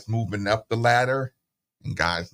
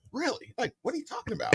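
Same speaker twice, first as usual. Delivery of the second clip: surprised; 13 semitones higher, 7 dB quieter, faster than usual. Retold or imitated. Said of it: "And I'm like".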